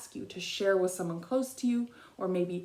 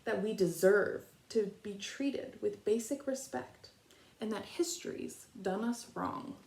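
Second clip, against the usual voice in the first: gentle read-aloud picture book voice, long pauses between verses; reading to children. Acted.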